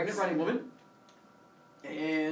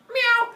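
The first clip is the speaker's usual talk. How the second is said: very high, loud meow; piercing silly cat cry as a monster; mock monster cry; 10 dB louder than usual. Giant python landing behind Leto, meowing loudly. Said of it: "lands behind her and goes".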